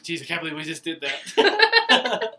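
slow, loud laughing disbelief; laughing relief. Retold or imitated. Relieved after awkward talk.

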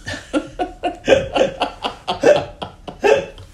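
high breathy laugh; high unvoiced laugh. Real, not acted. Laughing at the accent request.

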